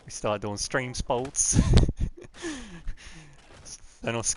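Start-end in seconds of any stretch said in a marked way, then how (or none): 1.7-3.2 high laugh. Real, not acted. Laughs at own joke.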